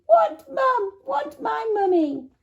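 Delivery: put-on wailing toddler. A narrator's reading. Baby wailing for mum.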